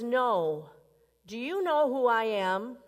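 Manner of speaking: as if everyone should know your name; indignant man. Acted. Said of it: "The man says".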